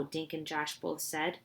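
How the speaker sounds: ordinary delivery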